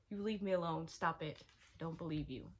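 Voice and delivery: normal talking voice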